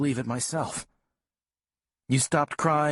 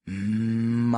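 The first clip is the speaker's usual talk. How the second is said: singsong coaxing. Retold or imitated. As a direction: sing the word "Mom" as a coaxing tune. Dad coaxing Callie to say Mom.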